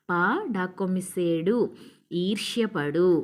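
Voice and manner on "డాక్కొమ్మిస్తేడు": ordinary talk, nothing marked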